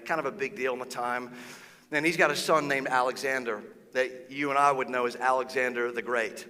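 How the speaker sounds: usual speaking voice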